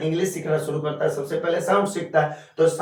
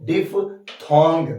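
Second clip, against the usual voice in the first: Diphthong broken slowly into DIF, THONG; slow, separated DIF... THONG for learners; slow term repetition; much slower than usual. Shown as a demonstration. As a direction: teach diphthong syllable by syllable. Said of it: "क्या बोलते हैं?".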